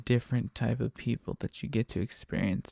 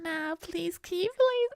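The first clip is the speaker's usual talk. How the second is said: lady passenger; slower than usual. Retold or imitated. Lady begging driver to switch songs, high imitated plea. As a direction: beg in a squeaky imitated woman's voice. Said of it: "then she's just like".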